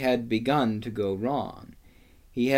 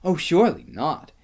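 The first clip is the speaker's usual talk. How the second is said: put-on protesting girl; 5 semitones higher, 4 dB louder than usual. A narrator's reading. Susan objects to Lucy.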